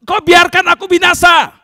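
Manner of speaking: high, loud accusing yells; angry shouting at God. Acted. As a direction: hurl each question as a furious rebuke. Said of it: "marah-marah kepada Tuhan". (teriak marah kepada Tuhan)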